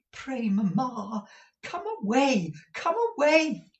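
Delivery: high, rising urgent plea; pleading daughter. A narrator's reading. Daughter begging her mother to come away, mildly urgent. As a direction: beg her to leave, lightly voiced.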